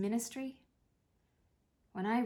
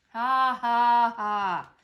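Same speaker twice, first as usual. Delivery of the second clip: loud deadpan ha ha ha; sarcastic mock laugh; 2 semitones higher, 12 dB louder, much slower than usual. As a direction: spell out the laugh flatly to show it's not funny.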